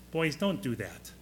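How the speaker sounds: soft, limp little scolding; a meek half-hearted telling-off; mild soft scolding. Retold or imitated. Jesus as he is not pictured, scolding the disciples weakly. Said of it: "It's not this".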